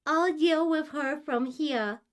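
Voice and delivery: high, slow, loud put-on ooh voice. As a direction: drag it out in a high, silly ooh-ooh voice. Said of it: "Not the freaking ooh, ooh voice again".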